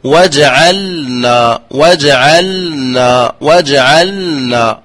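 slow, careful وجعلنا with the middle sound held midway; teacher drawing out وجعلنا for students; tajwid demo. Shown as a demonstration. Reciting teacher demonstrates وجعلنا slowly. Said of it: "فنقول".

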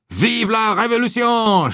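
high, slow, ringing cheer; cheering shout. Acted. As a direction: draw it out in triumph. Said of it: "shouted Ralph".

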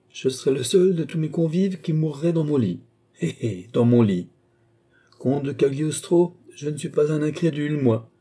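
put-on high-pitched, gloating old marshal; cackling triumph, pitched well up; gleeful sneering. A narrator's reading. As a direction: crow with self-satisfied glee. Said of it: "en ricanant comme eût fait Voltaire".

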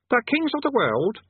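put-on high-pitched boy voice; demanding boy. Boy Eric claiming his due, high demanding voice. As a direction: insist like a kid, pitched right up.

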